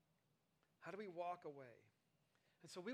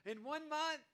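higher, louder, gleeful; excited parent announcement; 7 semitones higher, 7 dB louder, slower than usual. Retold or imitated. Parent telling toddlers about the beach trip, excited. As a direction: announce the big surprise to the kids. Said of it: "you're like".

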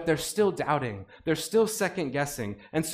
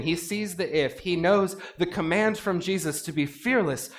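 ordinary delivery

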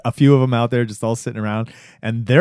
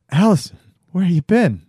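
put-on pleading boyfriend; 7 semitones higher, 5 dB louder than usual. Boyfriend voiced in retelling.

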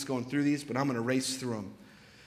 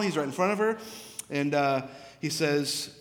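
ordinary talk

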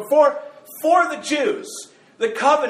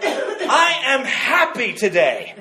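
forced cheer; 3 semitones higher, slower than usual. Retold or imitated. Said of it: "you're like".

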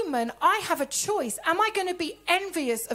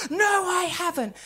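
mildly indignant denial; quick, mock-angry comeback; retold rage; 3 semitones higher, 3 dB louder, faster than usual. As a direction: imitate a friend firing back. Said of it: "Rage came up in my face".

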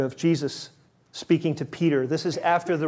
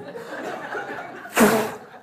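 breathy laugh; 6 semitones higher than usual. Real, not acted. Laughing at own slip.

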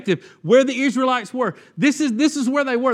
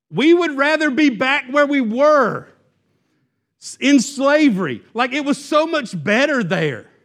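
voicing the Israelites; 5 semitones higher than usual. What did they just tell Moses.

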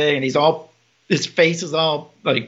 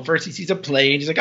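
usual speaking voice

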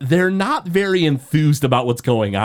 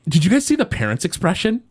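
usual speaking voice

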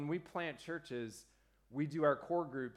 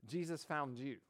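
usual speaking voice

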